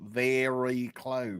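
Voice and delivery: slow, soft practice of an Aussie accent; Australian accent attempt. Shown as a demonstration. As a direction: draw out the Australian vowels, quietly. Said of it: "very close to an Australian accent".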